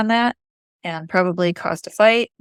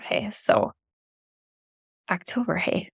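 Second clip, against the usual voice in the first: Upper Michigan accent, soft and drawn; Yooper accent; 8 dB quieter than usual. Real, not acted. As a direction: Yooper accent on October, hey. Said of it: "There's my Yooper accent".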